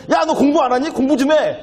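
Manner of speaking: high nagging mother's voice; nagging mom. Retold or imitated. Mother barging in on a studying child, high nagging. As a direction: nag like a mother interrupting homework.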